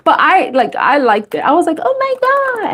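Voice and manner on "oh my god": amazed. Retold or imitated. Retold amazement.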